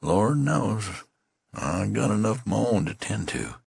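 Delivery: a hint of despair, high; weary, despairing reply; a touch of despair. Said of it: "little accent of despair".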